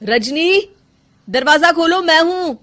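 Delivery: high, loud call; calling through a door. Acted. Husband calling to wife through shut door, loudly. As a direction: call out to be let in.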